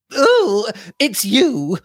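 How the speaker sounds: high, startled greeting; flustered older lady. A narrator's reading. Upset aunt greets the returning party, high and flustered.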